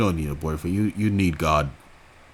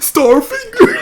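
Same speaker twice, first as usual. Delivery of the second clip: hammed-up mocking shriek; mocking shout; 9 dB louder, slower than usual. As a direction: shout it far higher and louder in mockery. Mocking the quoted phrase.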